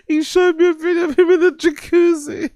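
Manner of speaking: very high squeal, cracking up; helpless laughter. Real, not acted. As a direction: squeal the repeated confession through real laughter. Laughing, repeats the confession.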